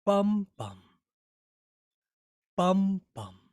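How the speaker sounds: strong then weak beat; first beat heavy. Shown as a demonstration. Poet tapping the rhythm of "sometimes". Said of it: "more like".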